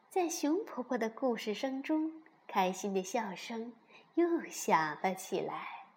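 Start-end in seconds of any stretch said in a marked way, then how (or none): none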